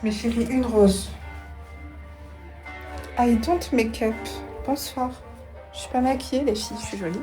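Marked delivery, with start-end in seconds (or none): none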